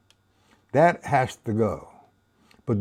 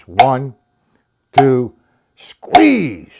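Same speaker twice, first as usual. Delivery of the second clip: counting demo with squeeze; 8 dB louder, much slower than usual. Retold or imitated. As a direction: call out the count, squeeze on top. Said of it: "He would teach you like this".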